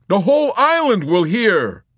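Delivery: loud, high-pitched snarl; angry snarl. A narrator's reading. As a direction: snarl it to silence him. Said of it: "Yossarian snarled".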